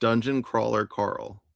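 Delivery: slow, clean "Dungeon Crawler Carl"; educated careful "Crawler"; perfect pronunciation. Shown as a demonstration. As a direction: say "Crawler" precisely, slowly. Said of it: "You say it perfect".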